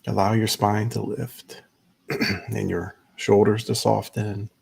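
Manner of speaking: unhurried meditation guide, long pauses between phrases; measured, spacious guiding voice; guided meditation. Acted. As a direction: ease listeners into stillness, leaving space after each instruction.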